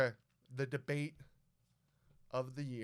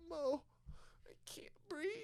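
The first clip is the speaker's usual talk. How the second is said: tiny high-pitched breathless whimper, imitated; mock crying; 12 semitones higher, 7 dB quieter than usual. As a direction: act out a tearful plea with barely any air. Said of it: "I'm even, like, crying".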